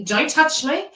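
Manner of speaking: mock-snappy, high and loud; irritable, snappy. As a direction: snap it in a pregnant partner's touchy voice.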